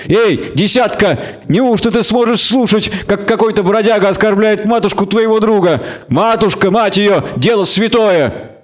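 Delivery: high-pitched, mock-outraged egging on; theatrical indignation from a tavern tough; inciting gang leader. A narrator's reading. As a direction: goad a mate into defending a friend's honour.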